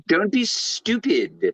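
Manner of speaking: put-on Surge's accent. Friend mimics Surge brushing off Billy, slow and high. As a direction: drag it out the way Surge said it. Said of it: "but the way he said".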